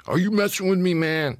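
loud high yell of disbelief; a hammed-up yell at a stranger. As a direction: shout it, caught off guard and offended. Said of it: "he started yelling".

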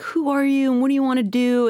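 high, bright mock producer voice; lightly put-on eager asker; imitating eager producer. Voicing imagined producers asking her, high mock voice. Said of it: "they're like".

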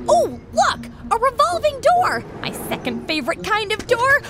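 high, bright-eyed delight; excited. Acted. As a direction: squeal over a door. Thrilled at revolving door.

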